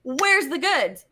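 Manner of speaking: mock exasperated wait; impatient. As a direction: cut him off, bored of his stuff. Impatient for the minerals.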